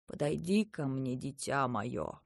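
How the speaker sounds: low, soft, slow and gentle; soft and quiet. A narrator's reading. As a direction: draw the child in gently. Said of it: "Голос вдруг стал мягким и тихим".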